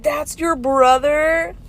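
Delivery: a high, slow, stunned question; child amazed to learn about his uncle; shocked kid. Retold or imitated. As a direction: voice the son's disbelief. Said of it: "my son was shocked".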